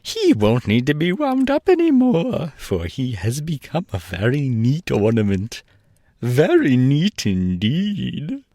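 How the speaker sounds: high, sly king voice, slow at the end; a small king pleased with his trap; high gloating monarch; gloating gnome king. A narrator's reading.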